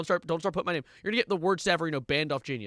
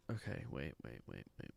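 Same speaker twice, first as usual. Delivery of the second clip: far lower, much softer fading murmur; stunned murmur; 17 dB quieter than usual. Real, not acted. Streamer stopping while reading an article, stunned quiet murmur. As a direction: let the words die away in shock.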